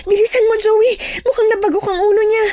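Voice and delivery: high, fast, panicked cry; alarmed friend. Acted. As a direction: urge them to hurry in a high, alarmed voice. Alarmed friend, father has fallen. (mataas at natatarantang sigaw)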